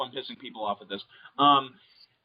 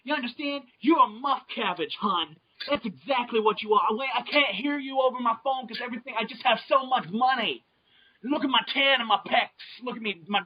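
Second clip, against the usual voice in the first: put-on New Jersey accent; 6 semitones higher than usual. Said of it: "the New Jersey accent".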